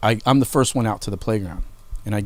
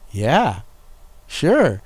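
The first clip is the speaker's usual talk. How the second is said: drawn-out, swooping mock-innocent yes; two separate words, each with an exaggerated pitch arc; sly agreement; 2 semitones higher than usual. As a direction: agree sweetly while plotting payback. Plotting payback while agreeing.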